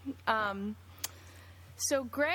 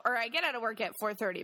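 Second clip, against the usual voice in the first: ordinary delivery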